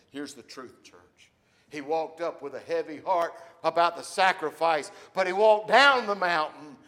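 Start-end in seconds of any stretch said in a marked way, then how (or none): 1.7-6.7 loud, ringing preacher's voice; preaching. Acted. Pastor preaching to the church, full force. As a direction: preach it out to the congregation.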